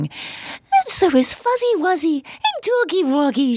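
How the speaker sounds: very high, sugary puppet-show singsong; mock a cutesy storyteller way up high; saccharine voice. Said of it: "this saccharine voice going".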